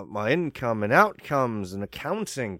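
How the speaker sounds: ordinary delivery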